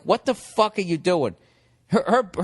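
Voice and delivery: ordinary talk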